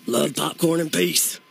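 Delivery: a lightly voiced local friend; just hint at Buddy's voice on request; barely shifted Buddy voice; Buddy Creef impression. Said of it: "Can we do a Buddy Creef, uh, accent?".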